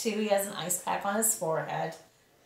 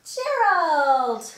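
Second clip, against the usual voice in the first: calling out a name with a falling end; loud call; 7 semitones higher, 9 dB louder than usual. Acted. Piggy calling out to her friend Gerald, loud call. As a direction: shout the friend's name across the yard.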